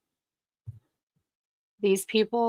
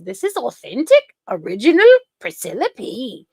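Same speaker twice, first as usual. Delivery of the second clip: higher, louder mocking voice with a rising then falling tune; mocking imitation; 5 semitones higher, 8 dB louder than usual. Mocking the streamer's intro in a louder put-on voice. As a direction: ham up her self-introduction. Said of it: "she'll do it five or six times".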